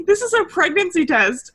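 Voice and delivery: high, loud quote through laughter; laughing retelling. Retold or imitated. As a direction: fight giggles while acting out the line. Retelling scene while cracking up.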